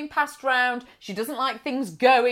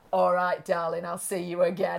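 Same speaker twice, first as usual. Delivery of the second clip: lower, softer mimicked goodbye; low-voiced northern farewell; 6 semitones lower, 4 dB quieter than usual. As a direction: lightly imitate a northern host seeing guests off. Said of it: "Like this".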